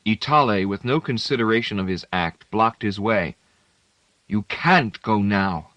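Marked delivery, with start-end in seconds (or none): 4.3-5.7 slow, fierce and firm block; a fierce, deliberate command; fiercely. A narrator's reading. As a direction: stop him with fierce resolve. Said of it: "he said fiercely".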